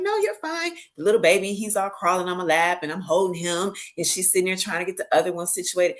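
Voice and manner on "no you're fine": reassuring. Retold or imitated. Reassuring the young mother.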